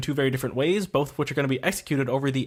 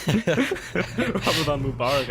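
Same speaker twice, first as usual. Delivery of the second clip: laughing; much slower than usual. Real, not acted. Laughing reply.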